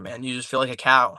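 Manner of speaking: ordinary talk, nothing marked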